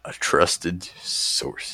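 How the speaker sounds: very slow, hushed and low; coyly evasive. Real, not acted. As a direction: draw out each word to dodge the question. Evasively repeats when asked who told.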